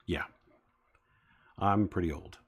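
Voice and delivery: ordinary talk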